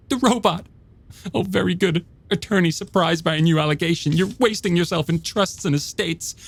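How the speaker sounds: high, biting mockery of her husband; a wife tearing into her husband with scorn; sarcastic wife. A narrator's reading. Joanna's sarcastic tirade.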